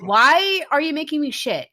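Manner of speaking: annoyed king. Retold or imitated. King complains about ugly sweets.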